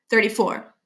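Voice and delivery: plain reading voice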